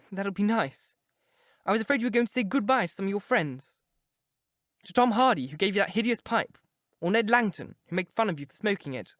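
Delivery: put-on a lovestruck girl pouring her heart out; young girl in love. A narrator's reading. Sybil teasing her brother.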